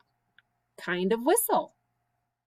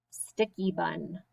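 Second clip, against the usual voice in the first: ordinary delivery, nothing marked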